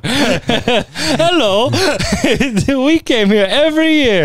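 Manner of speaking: high, laughing mock Polish accent; a bad, giggly Polish accent. Polish ghost family knocking on the door, in a laughing mock Polish accent. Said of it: "I don't know what Polish accent that was".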